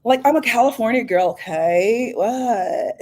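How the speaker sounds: put-on high, sassy Valley Girl voice; playful Valley Girl, rising "okay?". Shown as a demonstration. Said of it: "I could put my Valley Girl on".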